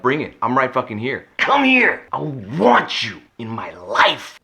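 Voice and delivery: high, loud taunting yell; mock-furious shout, come and get it; lost temper. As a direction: bellow the dare at him, losing it. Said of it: "I probably lost my temper".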